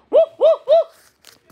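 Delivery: high, loud, eager woofs; loud puppy bark. Acted. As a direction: bark hard like a big puppy. Puppy barks loudly.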